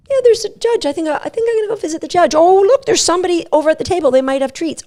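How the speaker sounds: high, fast, chirpy dog voice; distracted dog. Dog drifts off to visit the judge, high and fast. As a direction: rattle it off like a dog spotting treats. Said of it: "Some dogs might give you the feedback".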